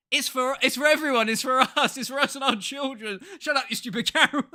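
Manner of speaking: high, loud, mock-outraged squeal; mocking the panel with a high outraged voice; mock indignant panel. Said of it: "And they were like".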